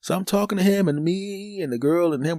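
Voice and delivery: ordinary delivery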